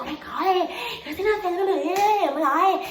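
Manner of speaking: put-on high, gushing girl voice; mock amazed girls. Girls amazed at Italian opera, excited high voice.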